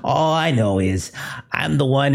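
high, giggling menacing villain; creepy villain voice. Acted. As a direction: gloat like an unhinged captor.

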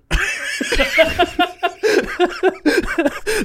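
long high-pitched laughing fit; laughter. Real, not acted. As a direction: burst out in helpless high laughter. Joking about the hat.

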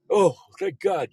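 exaggerated sigh of relief; mock relief. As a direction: play a freaked-out stranger suddenly relieved. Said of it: "they'd all be freaking out".

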